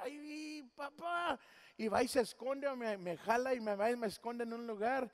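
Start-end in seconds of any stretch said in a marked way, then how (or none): 0.0-1.4 son's embarrassed cry. Retold or imitated. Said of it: "empiezo a gritar fuerte".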